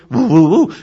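high, loud playful woo woo woo; excited dog hooting; happy doggy woos. Retold or imitated. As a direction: yip with delight like a happy pup.

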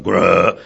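mock dog growl; a short rising dog growl; imitated growl. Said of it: "Rovers went".

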